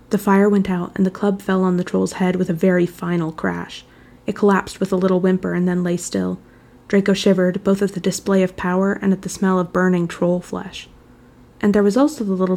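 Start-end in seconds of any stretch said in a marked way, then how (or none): none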